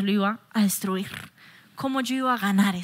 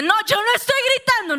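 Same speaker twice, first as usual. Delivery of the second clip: high, raised-voice mock protest; acted shouty denial; 10 semitones higher, 4 dB louder than usual. People denying that they shout, acted shouty protest. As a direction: deny it while your voice climbs. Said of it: "eso la gente dice".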